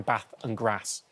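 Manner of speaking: BATH and GRASS with the short A of cat; northern short A. Shown as a demonstration. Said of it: "you know I'm from the north".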